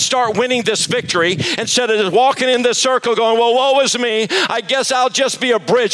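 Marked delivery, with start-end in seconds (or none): 3.4-5.9 high, whiny woe-is-me voice; mock a self-pitying whiner, pitched high. Mocking self-pitying believer.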